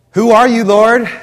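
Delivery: loud, high cry; cry to heaven. Acted. Saul on the road crying loudly up to heaven. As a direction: call up to the blinding light.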